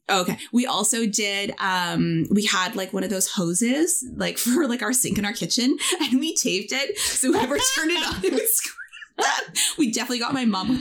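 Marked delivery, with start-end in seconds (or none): 6.0-10.8 helpless giggly storytelling, rising in pitch; laughing. Real, not acted. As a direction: voice climbing high as laughter takes over. Laughing while telling the hose prank.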